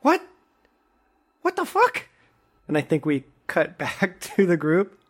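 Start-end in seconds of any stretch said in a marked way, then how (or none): 0.0-2.1 very high, stunned yelp; shocked outburst. Acted. Boy reacts to his talking cat, very high stunned yelp. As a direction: blurt it in disbelief.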